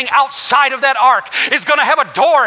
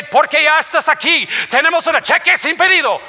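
speaking Spanish; 5 semitones higher than usual. Shown as a demonstration. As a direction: show off a bit of Spanish. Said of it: "I can speak some of my Spanish".

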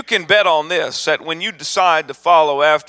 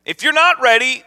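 loud, high, driving sermon build; preaching at full force; 7 semitones higher, 3 dB louder than usual. Acted. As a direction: preach it out to the congregation. Preaching climax, rising intensity.

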